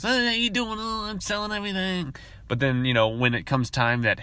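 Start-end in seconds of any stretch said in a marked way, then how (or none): 0.0-2.1 very high, sugary sales voice; mocking the top salesman. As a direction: gush like a smug top seller. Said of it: "They have top sales".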